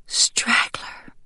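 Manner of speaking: hushed breathy whisper of grief; grieving whisper. Acted. Grieving hen whispers to the dead duck, hushed. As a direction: whisper his name through the pain. Sprout grieving over Straggler.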